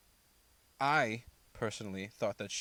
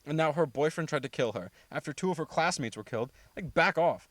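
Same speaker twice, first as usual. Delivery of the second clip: high, sharp indignant outburst that gets louder at the end; 5 semitones higher, 3 dB louder than usual. Retold or imitated. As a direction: take her side, outraged, and finish with a loud back off. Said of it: "And I was like".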